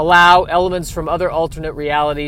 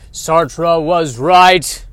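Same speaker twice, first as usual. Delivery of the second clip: very slow, heavy pronouncement; slow dramatic declaration; 6 dB louder, much slower than usual. Acted. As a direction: drag each word out like a doom prophet.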